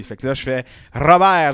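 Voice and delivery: «Robert» with a strongly rolled tongue-tip R. Shown as a demonstration. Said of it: "c'est les R".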